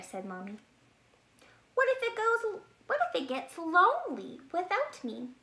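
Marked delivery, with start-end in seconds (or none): 1.7-5.3 much higher, anxious; worried cub. Acted. Bear cub worries the acorn will be lonely. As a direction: fret for the little egg.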